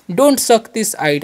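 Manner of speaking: very slow, every word separate; slow model sentence. Shown as a demonstration. Teacher reading an example sentence to students, very slow. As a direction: lay each word out slowly for learners. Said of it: "इस तरीके से भी बोल सकते हैं".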